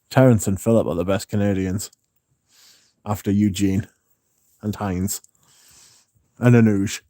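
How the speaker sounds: ordinary talk, nothing marked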